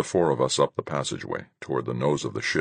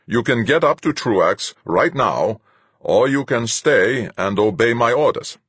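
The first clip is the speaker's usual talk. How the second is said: loud, slow, higher captain's voice; commanding captain, cold; stern captain; 8 semitones higher, 6 dB louder than usual. A narrator's reading. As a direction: lay it out like a hard captain. Captain Fuchs' voice.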